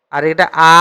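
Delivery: normal talking voice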